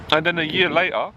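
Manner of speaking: usual speaking voice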